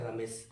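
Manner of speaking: रमेश, soft and quick, no long vowel; read gently. Shown as a demonstration. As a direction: say रमेश lovingly, short र. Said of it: "हम इसको प्यार से पढ़ेंगे".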